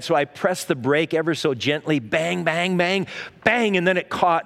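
usual speaking voice

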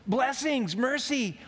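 high, desperate pleading shouts; frantic cries. Acted. Rider racing to the precipice, high frantic cries. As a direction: grasp at any word in terror. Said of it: "And so he yells out".

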